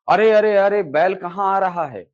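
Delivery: a teacher's hammed-up cry of alarm. Shown as a demonstration. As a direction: act startled at a charging bull. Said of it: "भय को दिखा रहा है".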